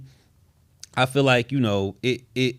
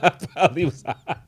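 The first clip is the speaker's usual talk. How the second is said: higher, through a laugh; mom's reply, laughing; 4 semitones higher, faster than usual. Retold or imitated. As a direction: retell mom's admission while cracking up. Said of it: "She's like".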